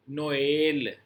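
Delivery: नोएल with ओ and ए sounded apart; नोएल, each vowel pronounced separately. Shown as a demonstration. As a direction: say नोएल as नो-एल, two clear vowels. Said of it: "ओ का sound अलग आएगा".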